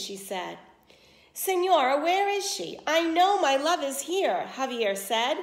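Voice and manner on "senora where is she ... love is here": higher, louder urgent asking; eager suitor. Acted. Javier urgently demands where his love is, loud and high. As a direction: burst in searching for his love.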